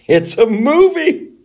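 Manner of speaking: high, loud and drawn out; shrieked retort. Retold or imitated. Yelling at complaining viewers, exasperated shriek.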